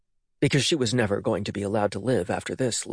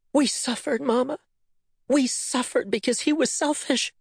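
tearful; 13 semitones higher than usual. A narrator's reading. Tears welled, daughter's voice.

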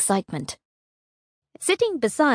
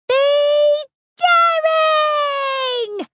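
high, loud excited shout; 4 dB louder, much slower than usual. Acted. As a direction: yell it as you leap. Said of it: "she shouted".

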